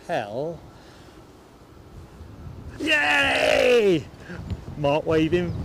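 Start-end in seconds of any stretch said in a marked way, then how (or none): 2.8-4.0 high, loud joyful yay; excited cheer. Real, not acted. Streamer cheering as he spots Linda on camera, excited shout. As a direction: cheer out loud on spotting a friend.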